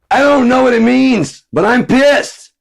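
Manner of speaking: high, loud, slow mock rant; angry dumb guy. Mocking an ignorant guy who is angry without knowing why, high loud rant. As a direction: shout it like an ignorant hothead.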